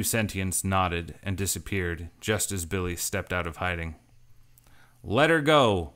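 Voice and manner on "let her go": loud, high commanding yell; shouting. Acted. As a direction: shout it across the canyon. Billy bursts out of hiding.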